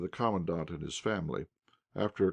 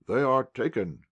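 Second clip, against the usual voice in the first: louder, proclaimed with pride; emphatic announcement; 5 semitones higher, 5 dB louder than usual. A narrator's reading. Officer proclaims the arrest to the household, emphatic. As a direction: announce it like a triumph. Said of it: "exclaimed emphatically".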